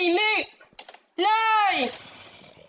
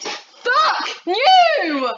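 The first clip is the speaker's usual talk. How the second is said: loud, higher furious shout at the game; 3 semitones higher, 6 dB louder than usual. Real, not acted. Gamer cursing the game, furious shout. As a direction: scream it at the screen.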